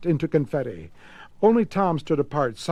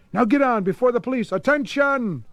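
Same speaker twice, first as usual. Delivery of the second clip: higher, urgent call to attention; 8 semitones higher than usual. Acted. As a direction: hurry them along. Grigsby urging, then calling out.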